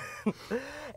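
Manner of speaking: a soft high laugh; high breathy laugh. Real, not acted. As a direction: laugh quietly at the memory. Laughing at own story.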